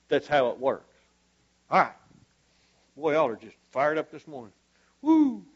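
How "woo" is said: short, high-pitched woo; excited whoop. Real, not acted. Preacher reacting to a fired-up congregation, high whoop. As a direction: let out a quick high whoop.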